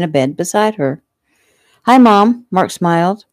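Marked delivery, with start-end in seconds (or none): 1.8-2.5 excited. A narrator's reading. Child greets mother happily.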